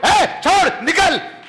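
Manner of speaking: high loud barking rebuke; commanding shout. Shown as a demonstration. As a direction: shout the spirit out with authority. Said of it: "अधिकार के साथ डांटो".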